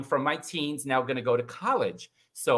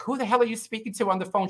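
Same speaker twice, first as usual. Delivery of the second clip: higher and fast, a barging-in demand; imitating sister's alarm; 10 semitones higher, faster than usual. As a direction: sister bursts in mid-call, voiced higher and fast.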